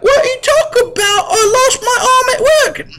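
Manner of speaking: high, outraged mock yell with a laugh; cartoonish high kid protesting his firing; hammed-up child shout. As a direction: shriek in disbelief like a little kid.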